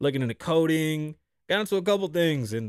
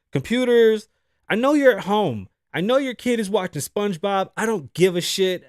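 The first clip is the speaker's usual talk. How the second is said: angry rant at computers; 6 semitones higher, 5 dB louder than usual. Acted. Ranting at automated phone computers.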